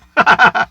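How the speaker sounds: high loud laugh; loud burst of laughter. Real, not acted. Laughs at viewer joke.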